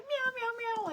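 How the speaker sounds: very high whiny meows; complaining meows. Retold or imitated. Girl meowing complaints at the caller. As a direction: meow like a cat grumbling.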